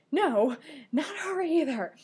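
high, loud mock protest; imitated dismay. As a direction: wail it like a dismayed character. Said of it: "and he's like".

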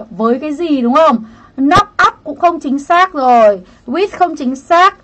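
ordinary talk

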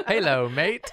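mock Aussie Halo, mate; laughing fake Australian greeting; Australian accent. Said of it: "You think they say halo in Australia".

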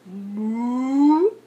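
long imitated moo; drawn-out cow noise. A narrator's reading.

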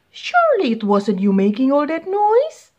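can't believe it's the bird; disbelief. Acted. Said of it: "asked the beast in disbelief".

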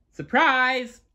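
gleeful yell, pitched high; spring the surprise with a yell; a high gleeful shout; high playful yell. A narrator's reading. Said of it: "Anna yelled".

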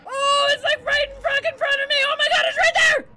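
high, loud panicked cries; panicked yelling. Real, not acted. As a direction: yell in real terror. Monster right in front.

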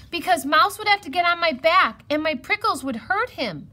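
high, loud, hurried excuse; worked-up high voice; protesting hedgehog. A narrator's reading. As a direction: protest in a rush.

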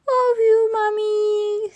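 put-on high, loud toddler voice; George. A narrator's reading. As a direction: coo it like a baby brother, drawn out. Toddler George echoes Peppa's words.

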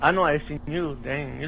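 gender swap filter. Acted. Caller voice through a voice filter.

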